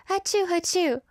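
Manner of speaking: high playful fake sneezes; two hammed-up little sneezes. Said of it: "now I'm just like".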